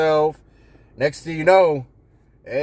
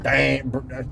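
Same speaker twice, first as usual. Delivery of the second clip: lower, softer and stumbling; fumbling awkward boss; 5 semitones lower, 4 dB quieter than usual. Pretend. Nervous new boss fumbling through feedback to a friend. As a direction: mumble it like you're dodging the point. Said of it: "like the first time I said it".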